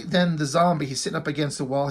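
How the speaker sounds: ordinary delivery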